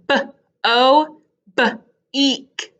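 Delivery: B, pause, O, B, pause, EEK; lifted, segmented b...o, b...eek; sounded-out B. Shown as a demonstration. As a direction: break B-o and b-eek after the first sound. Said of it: "They're the same".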